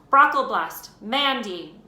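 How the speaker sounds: loud roll call across the hall; calling out a name. A narrator's reading. Teacher calling out names.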